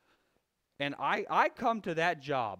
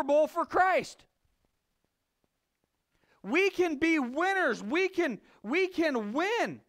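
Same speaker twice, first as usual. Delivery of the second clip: loud, high-pitched preacher's rally cry; rally the church like a coach before the big game; fiery preaching; 10 semitones higher, 4 dB louder than usual. Acted. Preacher rallying the congregation, loud fiery preaching.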